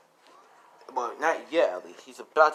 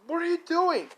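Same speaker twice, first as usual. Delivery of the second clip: very high, louder outcry; acted scream of alarm; horrified yell; 5 dB louder than usual. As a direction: shout it in shocked protest. Boy barges in on torture.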